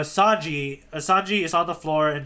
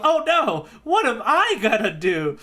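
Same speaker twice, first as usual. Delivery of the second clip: high, loud mock panic; 8 semitones higher, 4 dB louder than usual. Luffy realizes he can't dive, in mock panic. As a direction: squeal it like a panicked cartoon hero. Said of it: "But then he goes".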